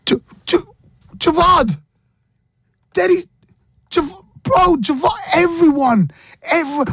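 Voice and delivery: high, hammed-up wail of heartbreak; radio host mock-mourning a friend's wedding; mock heartbroken. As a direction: play the jilted friend, over the top.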